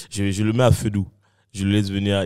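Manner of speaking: ordinary delivery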